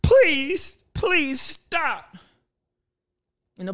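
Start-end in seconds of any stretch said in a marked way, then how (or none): 0.0-2.2 high, slow, panicky begging; high mock-desperate plea; desperate pleading. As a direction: plead with the ball to stop. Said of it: "you're like".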